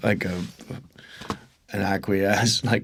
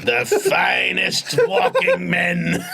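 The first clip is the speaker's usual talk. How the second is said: monster truck ad; 6 semitones higher, slower than usual. Pretend. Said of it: "like, a monster truck advert for it".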